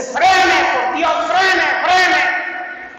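high, loud desperate yell of brake; shouted warning. Retold or imitated. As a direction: scream at the speeding driver to stop. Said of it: "alguien te grita". (grito desesperado: ¡frene!)

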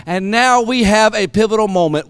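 usual speaking voice

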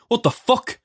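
high-pitched startled reaction; startled, high-voiced disbelief; startled outburst. A narrator's reading. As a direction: blurt it out, baffled, pitched well up. Startled waking on the bus floor.

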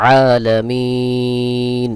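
আলামিন with very long held মি; three-alif stretch. Shown as a demonstration. Teacher recites word before translating it, আলামিন drawn out long. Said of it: "এখানে তিন আলিফ টান হবে".

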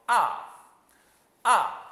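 "아" starting high, plunging sharply, loud; fourth tone. Shown as a demonstration. Teacher modelling the fourth tone, loud sharp drop. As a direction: fire "아" straight down from the top. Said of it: "사 성".

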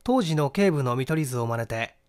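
ordinary delivery, nothing marked

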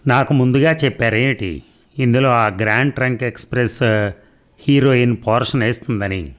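plain reading voice

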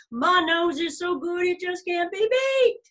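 high, loud crowing; boastful bear. Acted. Bear praising his own nose, boastful. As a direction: brag with total triumph.

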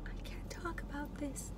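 hushed, unvoiced whisper; whispered secret. Imagined famous vlogger hiding her feelings, whispering. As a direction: whisper it like it's forbidden. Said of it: "I'd be like".

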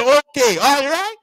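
high, loud check on the class; punchy mock-stern call; strict teacher. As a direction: imitate a teacher demanding agreement.

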